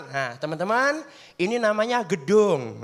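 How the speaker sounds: slow, drawn-out guide voice; slow tour guide. Acted. Senior showing new students the school, slow guiding voice. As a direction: spell out each word for the new kids.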